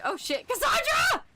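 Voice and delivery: high loud shock at a fallen ally; alarmed shout. Real, not acted. Streamer shouting at dead companion Cassandra in alarm. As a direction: yell it, real panic as she drops. Companion dies mid-fight.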